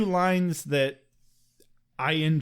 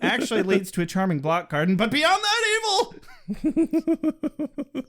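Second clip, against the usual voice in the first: mock-dramatic oration; 3 semitones higher than usual. Podcaster narrating Giles's path of evil, hammed-up drama.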